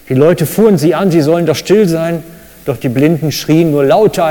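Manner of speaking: ordinary delivery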